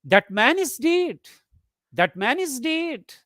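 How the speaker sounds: demonstrated surprise, pitch swooping up on "dead"; surprised rising tone. Shown as a demonstration. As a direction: lift DEAD high as if you don't believe it. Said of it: "you speak it in a rising tone".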